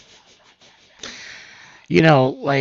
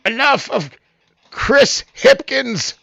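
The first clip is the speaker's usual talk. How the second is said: stretched, slow, drawn-out name announcement; headline read; 11 semitones higher, 6 dB louder, slower than usual. Shown as a demonstration. Host reading the news headline, slow announcer drawl. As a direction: announce the name like a big reveal. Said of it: "Wait, I wanna do it one more time".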